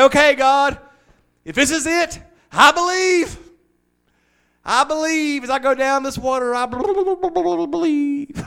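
very high, loud, last I believe drawn out slowly; fervent belief. Retold or imitated. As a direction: cry out your belief as you go under. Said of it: "I should at least be able to say".

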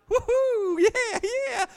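high, squealing mock woohoo; mock giddy high. Preacher mimicking a joy-pill high, squealing. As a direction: play someone buzzing on a pill. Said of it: "You might be like".

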